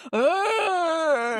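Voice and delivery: hammed-up grunt of a fallen player; mock pained grunt. Friend imitating Juliette Lewis flopping like a soccer player, mock pained grunt. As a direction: flop to the ground with a theatrical ugh. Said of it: "she's like".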